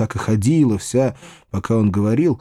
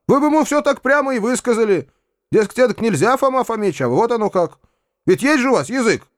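high-pitched, vehement urging; impassioned, raised remonstrance; heated outcry; 12 semitones higher than usual. A narrator's reading. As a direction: challenge them hotly. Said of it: "в запальчивости закричал я".

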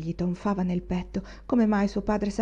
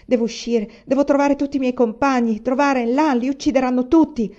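raised, agitated voice; angry urgency; 5 semitones higher, 6 dB louder than usual. A narrator's reading. Son desperate to find his companions, urgent and angry. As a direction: press on urgently, louder and higher. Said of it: "Afferrò con rabbia i suoi panni".

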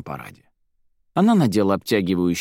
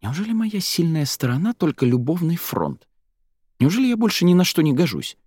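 higher-pitched, indignant questions; exasperated, raised pitch; vexed; 8 semitones higher than usual. A narrator's reading. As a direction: protest in hurt annoyance. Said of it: "Девушка была раздосадована".

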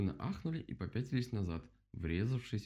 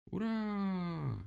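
long, high hurrah; cheering; 12 semitones higher than usual. A narrator's reading. Animals welcome the mole's idea, cheering. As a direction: stretch out a gleeful cheer.

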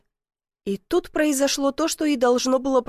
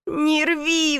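higher-pitched plea; pleading; 5 semitones higher than usual. A narrator's reading. Man being pulled by the legs, pleading. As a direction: beg her to go easy. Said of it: "взмолился".

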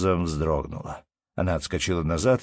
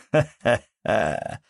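drawn-out, higher ha-ha-ha; father's acted laugh; 4 semitones higher, much slower than usual. A narrator's reading. Father delighted by his little son's complaint, acted laugh. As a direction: laugh heartily, stretching it out.

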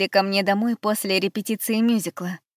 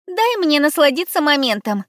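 high, louder demanding cry; demanding exclamation; 7 semitones higher, 6 dB louder than usual. Acted. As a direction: insist on your moment. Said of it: "требовательно воскликнула Габи".

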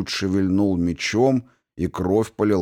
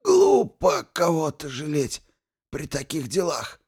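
strained wheezing voice; 8 semitones higher than usual. A narrator's reading. Archmage continues strained.

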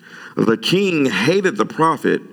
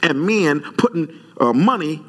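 higher, heated retelling of the rebuke; preacher retells confronting the barber, higher and heated; 7 semitones higher than usual. Retold or imitated. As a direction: press him hard, pitched up. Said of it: "I was very vulgar with him".

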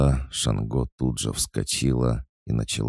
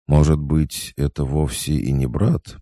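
higher-pitched, speculative lilt; tentative suggestion; 5 semitones higher, 7 dB louder than usual. A narrator's reading. Lara offering her friend another explanation, speculatively. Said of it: "предположила Лара".

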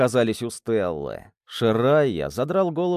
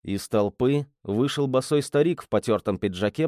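ordinary delivery, nothing marked

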